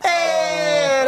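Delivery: high, drawn-out hey; sign-off holler. Real, not acted. Host closing out the episode, sign-off holler. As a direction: holler it out to the listeners. Signing off the show loudly.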